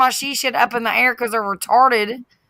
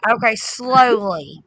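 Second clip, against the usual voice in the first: slow, deliberate words for a friend who can't follow; 2 semitones lower, slower than usual. Shown as a demonstration. Woman slows down so a confused friend understands, slow and deliberate. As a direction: stretch each word out on purpose. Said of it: "Okay, I'll slow down".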